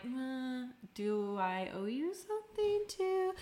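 high, slow, suspicious mock question. Woman to boyfriend's gift, high slow suspicious mock question. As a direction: act wary of a hidden motive.

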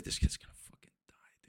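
hushed, voiceless whisper to himself; dread-filled whisper. Retold or imitated. Viewer foreseeing a kid's death in the film, dread-filled whisper. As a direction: whisper the realization as it dawns. Retells his dread while watching.

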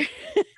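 a quick high giggle. Real, not acted. Giggling to the host about being a fainter. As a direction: laugh at yourself.

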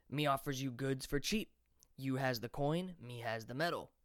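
a put-on voice for the toothless peddler, pushy; shabby merchant voice. A narrator's reading. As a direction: sell it like a toothless street hawker. Merchant character voice.

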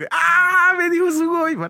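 squealing high sugoi, like a thrilled otaku; excited anime fan. Retold or imitated. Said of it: "Y yo".

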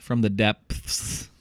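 "depths" over-enunciated slowly, with a lisped th; slow enunciation. Shown as a demonstration. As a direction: drag out "depths" slowly, each sound clear. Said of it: "If you really enunciate it".

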